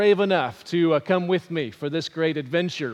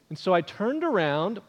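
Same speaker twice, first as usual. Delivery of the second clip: usual speaking voice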